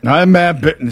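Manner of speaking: traffic reporter voice. Shown as a demonstration. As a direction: introduce yourself like a traffic reporter. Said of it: "that's just traffic stuff".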